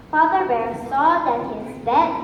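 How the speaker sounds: ordinary delivery, nothing marked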